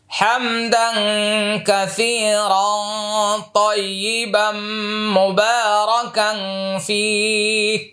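prayer recitation. Acted. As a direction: chant the words reverently and slowly. (নামাজের দোয়া সুর করে পাঠ)

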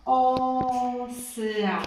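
slow, stretched out osya; drawn out. Shown as a demonstration. Teacher slowly sounding out osya for children. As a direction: read it out slowly, gliding on osya. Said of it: "jak czytać głośno".

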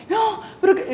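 high-pitched mock pleading of a tearful sister; imitated tearful protest. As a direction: imitate someone protesting through tears. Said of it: "ella estaba con los lagrimones".

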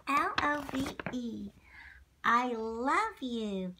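ordinary delivery

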